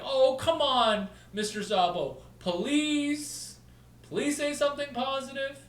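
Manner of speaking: high, loud, slow pleading whine; begging whine. Retold or imitated. Mock pleading with Nick for a positive quote. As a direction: beg like a desperate fan. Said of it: "Versus like".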